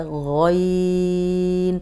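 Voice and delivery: غین held long and thick; slow heavy غین; heavy ghain. Shown as a demonstration. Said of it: "یہ موٹا حرف ہے".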